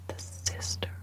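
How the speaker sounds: unvoiced hushed whisper. Real, not acted. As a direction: whisper it so no one hears. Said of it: "Why are you whispering?".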